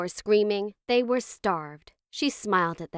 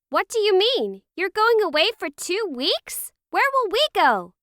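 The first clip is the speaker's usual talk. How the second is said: put-on high, louder alarmed boy's voice; panicked child; 12 semitones higher, 5 dB louder than usual. A narrator's reading. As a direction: fire off worried questions like a panicked kid. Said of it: "Max's voice was panicked".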